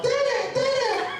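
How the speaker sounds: put-on very high-pitched little kid cry; child calling dad. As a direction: call out for dad in a small child's squeal. Child self calling dad mid-ceremony.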